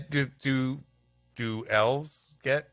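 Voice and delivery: ordinary talk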